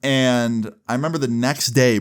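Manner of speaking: ordinary delivery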